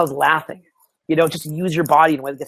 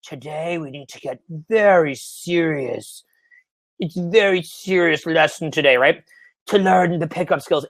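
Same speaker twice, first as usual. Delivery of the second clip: mock serious teacher; slower than usual. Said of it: "Like, like".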